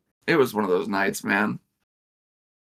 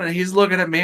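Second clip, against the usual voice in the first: usual speaking voice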